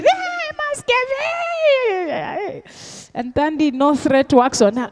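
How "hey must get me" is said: much higher, dragged-out mock wail of a child; screaming toddler tantrum. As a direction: wail like a spoiled kid rolling on the floor. Child's tantrum retold in supermarket.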